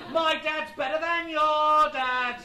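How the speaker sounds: loud, high, drawn-out playground boast; shouted taunt. Retold or imitated. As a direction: bellow it like a childish jeer. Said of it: "shouted Dionysus, son of Zeus".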